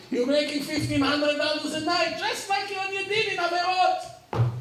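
high, loud, delighted burst to the student; excited exclaim. Retold or imitated. Rabbi tells his former student, the new DJ, in high excited voice. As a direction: crow it out in triumph.

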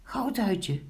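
high-pitched name call. A narrator's reading. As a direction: call lightly to a friend, high. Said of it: "riep Pinkeltje". (hoog roepend)

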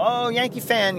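imitated stoked fan. Passerby spotting a fellow fan, mock excitement. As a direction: mimic a pleased fellow fan. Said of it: "all stoked on it".

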